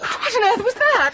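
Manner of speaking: high, loud alarmed cry; frightened by the sudden bang. Acted. Alarmed after the bump.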